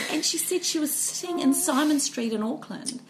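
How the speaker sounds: ordinary talk, nothing marked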